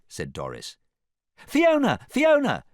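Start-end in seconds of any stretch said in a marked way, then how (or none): 1.5-2.6 high, loud calls; two squealed shouts for attention; excited cries. A narrator's reading. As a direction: call her name eagerly. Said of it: "cried the other fairy tale creatures".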